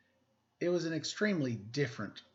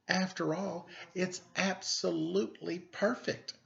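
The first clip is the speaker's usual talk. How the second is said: doting father; 7 semitones higher than usual. A narrator's reading. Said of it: "said her father".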